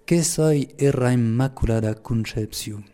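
slow Pyrenean patois pronunciation; deliberate local patois, measured pace. Shown as a demonstration. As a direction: say it slowly in the Lourdes patois. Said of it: "je vais le dire en patois".

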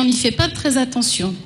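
ordinary delivery

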